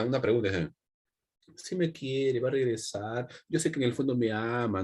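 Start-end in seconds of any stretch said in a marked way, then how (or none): 1.6-4.8 soft wistful imitation of a romantic; mock lovesick delusion. Teacher mocks a student convinced of being loved, wistful mock pining. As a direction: gently act out clinging to hope. Mimics deluded lovesick person.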